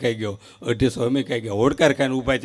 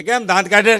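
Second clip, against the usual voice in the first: put-on child voice; 8 semitones higher, 5 dB louder than usual. Child asks why laughing.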